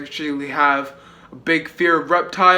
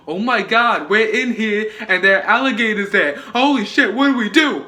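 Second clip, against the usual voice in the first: hammed-up panicked characters, higher and louder; mock horror-movie panic, pitched up; 5 semitones higher, 4 dB louder than usual. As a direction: play the trapped characters freaking out. Characters panicking over trapped alligators.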